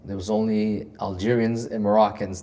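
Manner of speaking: ordinary talk, nothing marked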